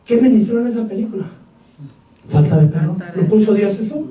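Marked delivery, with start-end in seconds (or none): none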